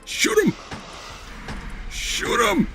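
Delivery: high-pitched frantic order; urgent shouting. Real, not acted. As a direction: yell desperately at your game character. Urges game character to fire.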